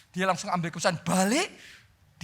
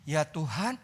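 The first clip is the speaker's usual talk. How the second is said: softer, hesitant admission; humble reply; 6 dB quieter, slower than usual. Retold or imitated. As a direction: confess it quietly, slowly on fileo. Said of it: "Dia hanya jawab gini".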